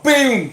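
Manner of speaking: loud, high boom; sudden shouted sound effect for the story; imitated crash. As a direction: punch out an exploding boom. Car smashing through traffic in story.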